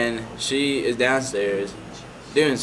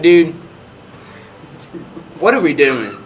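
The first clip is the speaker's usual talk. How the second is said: loud disbelief; 3 semitones higher, 5 dB louder than usual. Retold or imitated. Reacting to the doctor.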